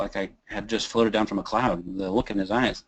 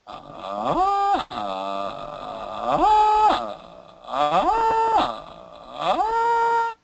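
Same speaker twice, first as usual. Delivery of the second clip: creak to falsetto; much slower than usual. Shown as a demonstration. Teacher demonstrating falsetto exercise, creaky voice to falsetto. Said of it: "creaky voice to falsetto".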